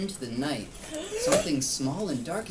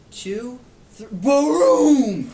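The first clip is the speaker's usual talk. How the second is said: louder playful thunder boom; 8 semitones higher, 16 dB louder, much slower than usual. Shown as a demonstration. Parent making thunder for child, loud playful boom. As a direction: burst out with the thunder. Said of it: "Count the seconds ... thunder rolls".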